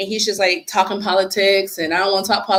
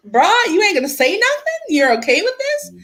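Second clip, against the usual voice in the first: pitched much higher, in disbelief at the wife; incredulous; 11 semitones higher than usual. Retold or imitated. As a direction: ask it like you can't believe she stays silent. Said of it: "I'm looking at her in the mirror like".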